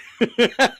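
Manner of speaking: a burst of loud laughter. Real, not acted. Laugh after retort.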